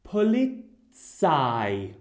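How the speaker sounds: "Polizei" said very slowly, each syllable clear; slow model word. Shown as a demonstration. Teacher modelling a German word for learners, very slowly. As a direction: spell out "Polizei" slowly with your voice.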